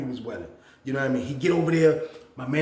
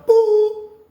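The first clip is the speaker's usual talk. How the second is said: high, loud imitated beep; intercom beep; 12 dB louder than usual. Intercom calls roommate's name, loud imitated beep. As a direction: make the intercom chime sound.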